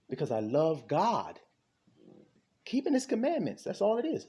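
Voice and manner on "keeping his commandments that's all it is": much higher, pressing voice; emphatic insistence. Real, not acted. Preacher explaining love of God, high and insistent. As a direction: drive the point home up high.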